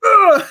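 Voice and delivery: loud, high effortful grunt; imitated spiking grunt. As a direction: grunt hard as if hitting a spike. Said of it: "we had to grunt".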